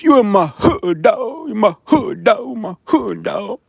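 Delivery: high, drawn-out mocking threat; mock a street heckler in a high, stretched voice. Streamer mimics a death threat from Tuskegee, mocking high-pitched tough guy. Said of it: "I got one of those".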